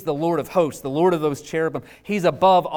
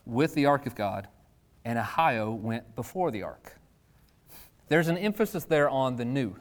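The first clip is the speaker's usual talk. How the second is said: normal talking voice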